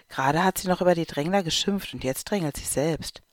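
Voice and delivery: usual speaking voice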